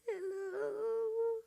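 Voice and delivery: put-on high, soft little girl's hello; a small child picking up the phone. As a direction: answer like a tiny shy girl. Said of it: "an adorable little voice came on".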